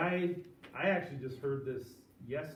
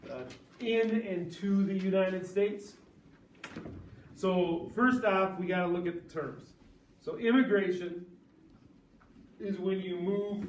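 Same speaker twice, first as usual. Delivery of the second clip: louder, higher classroom lecture delivery; 7 semitones higher, 10 dB louder than usual. Real, not acted. Teacher explaining immigration terms to the class, louder and higher. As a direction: project to the whole class, spelling out the terms. Teacher presents new topic loudly.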